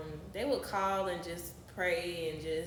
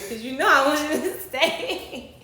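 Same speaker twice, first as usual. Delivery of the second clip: much higher and louder, laughing through an exasperated thought; laughing inner voice; 7 semitones higher, 11 dB louder than usual. Retold or imitated. Her unspoken reply to the boyfriend, laughing and exasperated. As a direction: let the laugh break into the last word. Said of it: "in my head, I was like".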